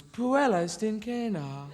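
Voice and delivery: slow, high, floaty delivery; floaty Roman accent. Retold or imitated. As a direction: let the Latin drift airily, drawn out. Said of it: "which they kept kind of floaty".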